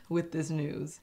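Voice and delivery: ordinary talk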